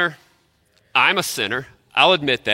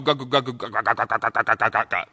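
low, softer mock grumbling "gagu, gagu"; grumbling noise; 4 semitones lower, 4 dB quieter, faster than usual. Pastor imitating grumbling workers, low mock mutter. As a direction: grumble it under your breath. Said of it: "They're grumbling".

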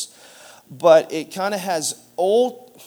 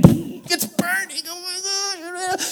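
pained cry; 13 semitones higher, much slower than usual. Retold or imitated. Mouthwash burn retold, acted.

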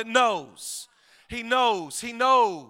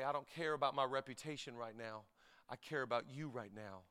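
much softer, low reflective close; quiet preaching; 7 semitones lower, 16 dB quieter than usual. Acted. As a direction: bring it right down, intimate. Soft closing reflection.

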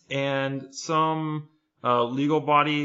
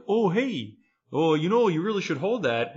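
higher, politely nagging mock officer; mock Canadian cop; 6 semitones higher than usual. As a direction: imitate a courteous cop giving a reminder. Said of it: "some cop was like".

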